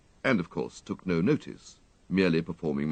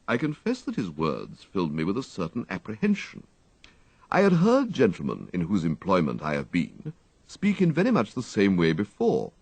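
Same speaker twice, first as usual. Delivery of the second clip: usual speaking voice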